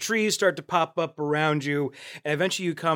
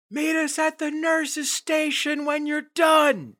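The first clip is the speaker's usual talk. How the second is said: raised call across a field; 13 semitones higher, 3 dB louder, slower than usual. Acted. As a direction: call out to the runners. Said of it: "calls out".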